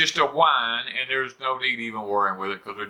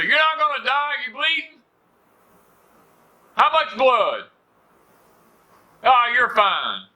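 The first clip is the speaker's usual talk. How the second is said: high, brisk mock mama brushing it off; dismissive mama; 11 semitones higher, 4 dB louder than usual. His mama brushing off his scrape, high and brisk. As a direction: toss it off like a no-nonsense mother.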